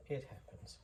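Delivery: breathy whispered echo. Real, not acted. Maker repeating it to himself, whispered. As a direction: whisper it to yourself.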